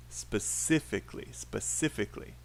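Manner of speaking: "Specifically" said low with stress on the second syllable; "Specifically" as a word drill, second syllable strongest. Shown as a demonstration. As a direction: model "Specifically", leaning on CIF. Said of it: "second syllable".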